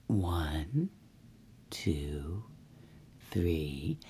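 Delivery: very soft, low counting; hushed count. A narrator's reading. Narrator counts as bears lie down, hushed suspense. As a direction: count slowly under your breath.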